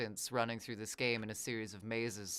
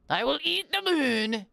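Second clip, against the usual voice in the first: mock villain shout; 9 dB louder than usual. Playful boast to chat.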